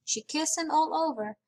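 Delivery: usual speaking voice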